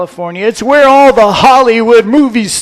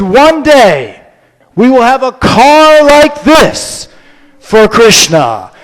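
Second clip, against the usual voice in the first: louder, slow and proclaiming; solemn declaration; 5 semitones higher, 7 dB louder, slower than usual. Acted. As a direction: announce it like a prophecy. Prabhupada points at the car.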